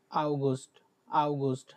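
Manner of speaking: আগস্ট said ow-goost; German August. Shown as a demonstration. Teacher models আগস্ট for the class. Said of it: "ওটা বলে আগস্ট".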